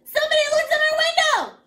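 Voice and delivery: high, loud alarmed shout; crying out. A narrator's reading. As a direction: yell the alarm to the whole house. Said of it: "Peter cried".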